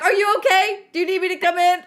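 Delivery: high, loud worried call; concerned coworker. Retold or imitated. Coworker checking in, high and loud. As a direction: call through the door, worried. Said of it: "she's like".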